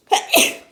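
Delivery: a sudden dry cough; real cough. Real, not acted. Reader interrupted, coughing for real. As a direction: cough mid-reading. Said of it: "Excuse me again".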